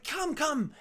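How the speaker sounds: high, hurried summons; urgent beckoning. Acted. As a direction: beckon them to follow at once. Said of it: "starts saying".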